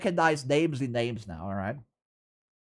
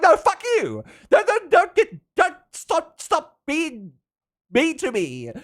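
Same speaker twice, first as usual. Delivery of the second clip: very high mock-angry squeal, easing off; retold tantrum; 14 semitones higher, 4 dB louder than usual. Telling teasing friends to stop being mean, mock tantrum. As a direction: stammer out a hammed-up, high protest. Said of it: "when I got angry".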